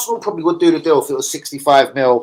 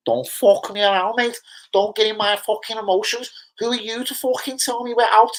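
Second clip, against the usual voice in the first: put-on higher, heated Scouse accent rant; Scouser fan impression; 9 semitones higher than usual. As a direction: mimic a riled-up Liverpool fan from Toxteth, pitched up. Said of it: "a Scouser straight face would actually say that".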